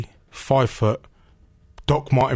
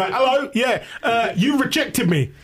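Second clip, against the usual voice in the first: high-pitched, drawn-out indignant confrontation; high comic outrage on the phone; indignant caller; 12 semitones higher, slower than usual. Retold or imitated. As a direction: put it to them in a high, slow, wounded voice. Acting out his phone call.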